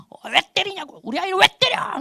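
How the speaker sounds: very high-pitched mock-outraged mom; acted high indignant protest; mother angrily confronting. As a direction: round on him like a furious mother. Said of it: "막 몰아붙이는 거예요".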